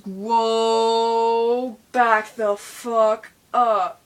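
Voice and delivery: mock alarmed crowd. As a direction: imitate people backing away in horror. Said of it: "everybody goes".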